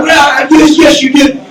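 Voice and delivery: high mock yell, insisting; retold girlfriend hitting back at Brock, yelling; indignant yell. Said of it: "And she's like".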